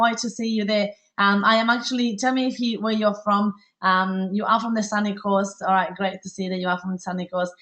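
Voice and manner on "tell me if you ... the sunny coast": usual speaking voice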